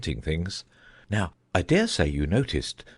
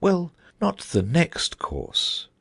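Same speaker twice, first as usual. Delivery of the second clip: slow and musing, higher; slow, thoughtful; 9 semitones higher, slower than usual. A narrator's reading. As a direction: drag it out, lost in thought. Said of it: "in a slow, thoughtful tone".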